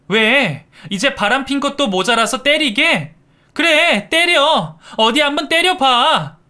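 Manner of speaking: high, loud shrieking dare; screaming defiance. A narrator's reading. Wife screaming at her cheating husband, high and loud. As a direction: scream at him to go ahead. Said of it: "바락바락 악을 썼습니다".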